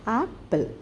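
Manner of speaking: "apple" broken into two separate beats; split into syllables. Shown as a demonstration. Teacher showing children how the word splits, "apple" in two syllables. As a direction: say "apple" as ap, then pul, with a gap. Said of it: "it splits".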